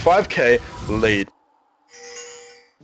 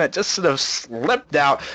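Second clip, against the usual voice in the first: usual speaking voice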